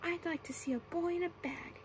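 high-pitched goblin; high storybook creature voice. A narrator's reading. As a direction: keep the goblin's voice lifted high. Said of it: "said the goblin".

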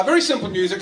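ordinary delivery

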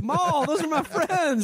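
put-on high, slow whine of a teenage son protesting; pleading son. Teen son whining up at his mom about his friends, high and drawn out. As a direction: stretch it out high like a kid begging his mom to back off.

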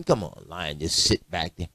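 usual speaking voice

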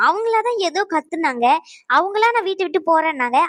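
usual speaking voice

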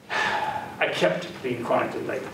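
sighed, soft and slow; resigned sigh. Real, not acted. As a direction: breathe it out, defeated. Admits lifelong lateness, with sigh.

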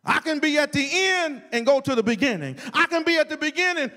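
emphatic, driving sermon cadence; full-force preaching. Real, not acted. Preacher telling congregation not to be afraid, mounting forceful preaching.

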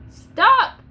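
loud, high shout to stop a fight; cried out. Acted. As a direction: yell it over the crowd to halt them. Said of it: "a familiar voice cried out".

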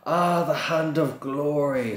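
high, unctuous salesman's coo; oily shopkeeper. Acted. Shopkeeper showing a boy a cursed item, oily. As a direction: slide into the sale like a greasy merchant. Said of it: "his oily voice".

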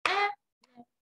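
Elephant in three separated beats, starting high; syllable-by-syllable clapping. Shown as a demonstration. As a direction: split Elephant into el, e, phant for clapping. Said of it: "Can we do it again?".

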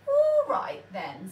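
very high, loud, slow mock-agreeable troll; troll agreeing. A narrator's reading. Troll agreeing to leave, slow and loud. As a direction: drag it out cheerfully. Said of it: "said the troll".

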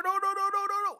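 rising panicked pleading; frantic, stuck and can't escape; high frantic no no no; panicked no's. Real, not acted. Player panicking while stuck in game.

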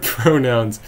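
normal talking voice

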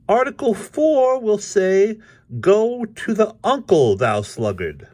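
very high, loud proclaiming cat; Krazy Kat. A narrator's reading. Krazy proposes articles to Ignatz, high proclaiming voice. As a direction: announce it grandly way up high.

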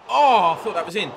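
loud, high wail of disbelief; shocked outcry. Real, not acted. Gamer crying out as his shot is saved, loud and high. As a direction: cry out as the ball stays out. Shot just misses in the game.

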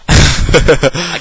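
words spilling out between laughs; laughing. Real, not acted. Friend laughing at a failed British accent.

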